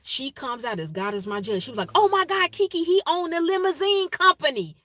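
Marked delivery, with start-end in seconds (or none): 2.0-4.8 gushing excited. Retold or imitated. Friend gushing about fiance.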